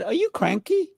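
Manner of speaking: high, caring "Are you cranky?"; gently worried check-in; concerned question. Shown as a demonstration. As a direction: ask it with real concern. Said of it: "they said it with a concern".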